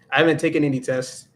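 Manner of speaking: ordinary delivery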